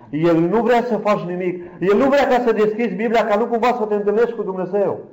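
high-pitched urgent warning from the pulpit; impassioned preaching. Real, not acted. Preacher warning believers about the enemy, impassioned. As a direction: raise the pitch sharply and drive the warning home.

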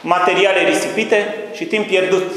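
high, loud impassioned appeal; fiery preaching. Acted. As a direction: plead with the congregation at full force. Preaching at full force. (predică aprinsă, sus și tare)

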